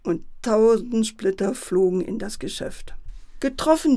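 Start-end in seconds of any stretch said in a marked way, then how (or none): 3.4-4.0 loud, high cheer; jubilant. A narrator's reading. Father celebrating the hit window, jubilant cry. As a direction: crow it in triumph. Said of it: "jubelte der Vati".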